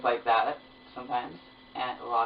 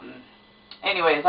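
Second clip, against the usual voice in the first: ordinary talk, nothing marked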